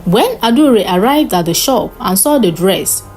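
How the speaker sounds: ordinary delivery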